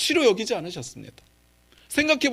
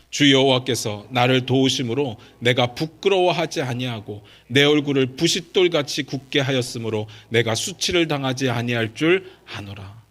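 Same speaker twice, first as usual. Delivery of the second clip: low, measured recitation on a level tune; Scripture reading aloud; 4 semitones lower, 3 dB louder than usual. Acted. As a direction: lead the congregation in reading, evenly. Leads the reading a second time. (낮고 고르게 성경 봉독)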